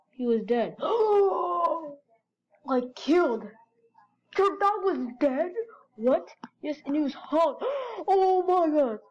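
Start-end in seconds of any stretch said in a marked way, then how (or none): 0.8-1.9 sharp frightened intake of breath; shocked gasp. Acted. Friend hearing the dog died, gasping in shock. 7.6-9.0 slow, higher, louder gasping cry; horrified cry. Acted. Friend hearing the dog was hanged, crying out in horror.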